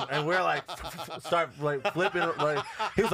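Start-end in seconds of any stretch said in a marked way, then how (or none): none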